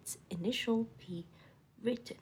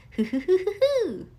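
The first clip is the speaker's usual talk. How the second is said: loud high whooping hoo-hoos; Tigger whooping; 8 semitones higher, 13 dB louder than usual. Acted. Tigger whooping loudly with excitement about kites. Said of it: "shouted Tigger".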